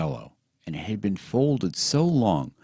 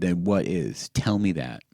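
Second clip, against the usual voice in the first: ordinary delivery, nothing marked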